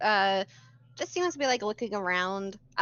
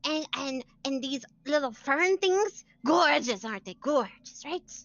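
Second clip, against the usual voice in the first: higher, excited bookish woman; eager NPC voice; 6 semitones higher than usual. Acted. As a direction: show off the crystals eagerly.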